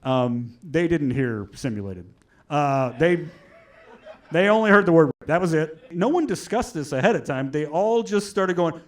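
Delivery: ordinary delivery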